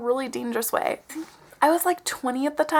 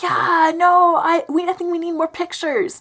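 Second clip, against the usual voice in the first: higher, pushy mock voice; imitating insistent date; 5 semitones higher, 3 dB louder than usual. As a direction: whine for it like a photo-happy date. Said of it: "He was just like".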